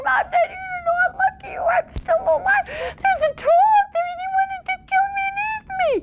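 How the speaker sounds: very high sobbing panicked voice; crying panic. A narrator's reading. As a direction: wail it out in tears.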